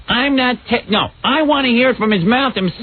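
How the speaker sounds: high-pitched imitation of an insistent caller; mock indignant demand. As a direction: act out a caller refusing the message. Acting out Jay's indignant phone demand.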